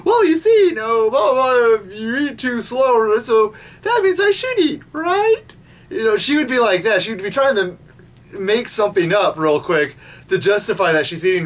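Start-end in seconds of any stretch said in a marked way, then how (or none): 0.0-5.4 high, fast cartoon girl babbling an excuse; mock Serena excuse. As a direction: rattle off a silly excuse as Serena. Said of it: "It's like".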